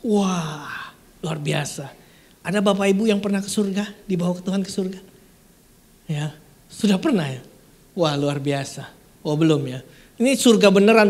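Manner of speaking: ordinary talk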